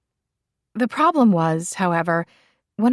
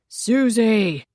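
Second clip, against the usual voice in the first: loud call through a door; raised mock bellow; 4 semitones higher, 4 dB louder than usual. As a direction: bellow her name, fed up. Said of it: "after a bellowed".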